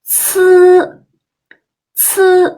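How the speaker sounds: ci like ts in cats with a strong puff of air; aspirated ci. Shown as a demonstration. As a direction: push air out on ci. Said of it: "The second one is".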